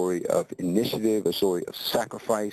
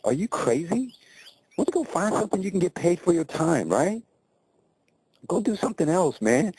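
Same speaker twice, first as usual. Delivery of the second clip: higher, pressing disbelief; a friend pushing him hard to quit, pitched higher; incredulous friend; 8 semitones higher than usual. Retold or imitated. Friend urging Joe to quit.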